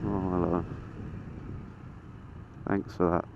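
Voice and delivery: usual speaking voice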